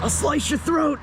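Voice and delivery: high-pitched hammed-up threat; mock threat. Vlogger acting out a mugger's warning, mock threat.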